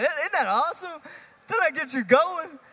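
high, loud, pumped-up enthusiasm; real excitement. Real, not acted. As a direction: fire up the crowd after the rock music. Reacting to rock music just played.

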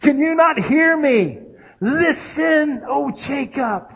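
high, loud shout for attention; God calling out. Acted. God trying to get his people's attention, shouting. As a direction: holler slowly across a crowd, urgent. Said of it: "It's like God's saying".

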